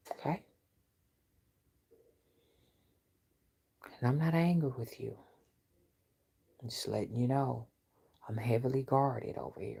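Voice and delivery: quiet menace like a strict mother; low menacing hush. Real, not acted. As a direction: drop the voice to a cold warning. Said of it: "That's how my mom used to talk to us when she meant business".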